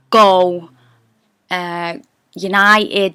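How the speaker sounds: usual speaking voice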